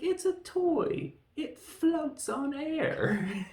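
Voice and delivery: very high, very slow mock-innocent voice; silly innocent voice. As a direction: play dumb in a squeaky sing-song. Cornelius covering up to Zaius.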